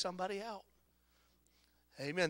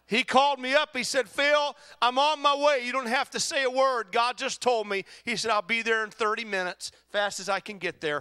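loud, raised preacher's storytelling; full-force preaching; 8 semitones higher, 9 dB louder than usual. Real, not acted. Preacher retelling his neighboring pastor's phone call, full-force preaching. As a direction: drive the story home in a high, loud voice.

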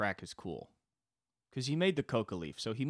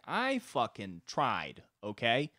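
exasperated single parent. Acted. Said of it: "like a single parent where it's like".

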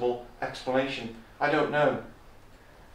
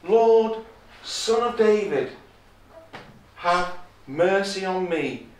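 raised, higher cry of appeal; 4 semitones higher, 4 dB louder, slower than usual. Acted. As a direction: call out loudly for mercy, easing on the plea. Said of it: "crying out".

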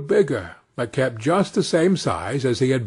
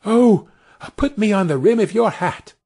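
put-on high, bright boy's voice; a tiny boy choosing his seat; Tom, cheerful little boy; 6 semitones higher than usual. A narrator's reading. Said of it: "said one of them".

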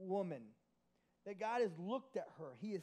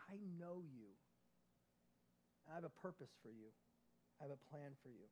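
tender voice of God; 5 semitones lower, 9 dB quieter than usual. Acted. God's words to Mary, softly voiced.

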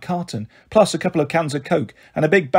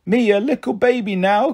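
put-on lisping child; 6 semitones higher than usual. A narrator's reading. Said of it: "Kendall lisped".

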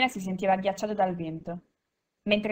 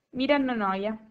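usual speaking voice

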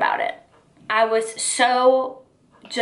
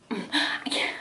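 breathy whispered I can't; 9 dB quieter than usual. Real, not acted. Vlogger whispers her disgust to viewers. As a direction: drop to a whisper, done with it. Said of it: "whispered aside".

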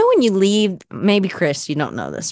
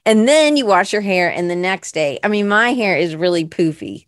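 usual speaking voice

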